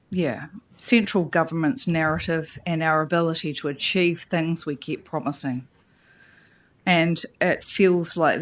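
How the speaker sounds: holding back tears while making her point; getting emotional. Real, not acted. Councillor debating coastal cuts, low and near tears. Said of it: "I'm sorry I'm getting emotional".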